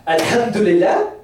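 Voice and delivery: mock praise with a questioning lift; hollow Alhamdulillah. Shown as a demonstration. Preacher showing hollow praise in Salah, mock rising tone. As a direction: Alhamdulillah said with a doubtful upward lilt. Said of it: "You see how it sounds in Salah".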